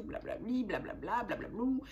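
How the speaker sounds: singsong swooping dismissive babble; self-mocking singsong filler; mock blah-blah. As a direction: rattle off nonsense syllables in rising-falling swoops. Mocks her own inner warnings.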